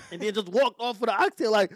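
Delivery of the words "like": high laughing outburst; incredulous laughing shout; laughing disbelief. Real, not acted.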